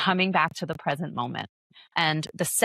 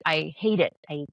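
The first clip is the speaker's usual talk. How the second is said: ordinary delivery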